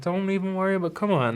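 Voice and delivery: high, gentle coaxing; coaxing parent. Retold or imitated. Parent figure coaxing a crying child soul, high gentle voice. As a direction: coax a kid back gently.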